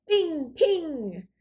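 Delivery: high ringing gunshot sound; ping like magic bullets; pistol pings. A narrator's reading.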